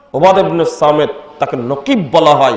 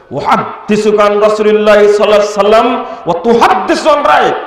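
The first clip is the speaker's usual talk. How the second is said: retold rebuke, raised pitch, rising indignant question; scolding rebuke; 4 semitones higher, faster than usual. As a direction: quote it as a sharp reprimand. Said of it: "ধমক দিয়ে বলছেন". (ধমকের সুরে)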